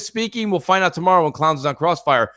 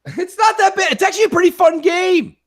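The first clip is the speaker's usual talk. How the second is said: high mock voice; 13 semitones higher than usual. Defending the game in high voice.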